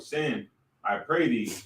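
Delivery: usual speaking voice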